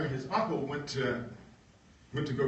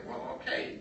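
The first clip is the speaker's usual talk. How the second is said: meek worker; 13 semitones higher, 3 dB quieter than usual. Retold or imitated. John agreeing meekly.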